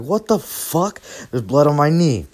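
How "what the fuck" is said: shocked. Retold or imitated. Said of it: "I was like".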